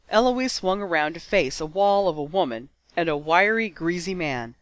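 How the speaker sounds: usual speaking voice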